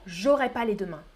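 j'aurai run quickly, ne dropped. Shown as a demonstration. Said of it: "ou plus rapidement". (plus rapidement, sans le ne)

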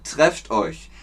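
ordinary talk, nothing marked

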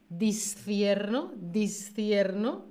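"discierno" slow, the sc said as a clear th sound; slow theta. Shown as a demonstration. Teacher shows her Castilian th, slow and clear. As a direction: say "discierno" slowly with a lisped th on the c. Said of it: "acordaos que yo discierno".

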